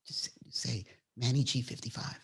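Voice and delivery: feed the line under your breath; acted quiet coaching; hushed prompting. Filmer quietly feeding the username.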